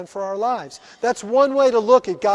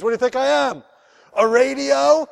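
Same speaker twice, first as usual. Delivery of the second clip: raised, higher mock exclamation; retold punchline shout; 6 semitones higher, 5 dB louder than usual. Pastor voices Steve telling the bus driver the joke, raised mock exclamation. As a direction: punch it out like a joke's payoff.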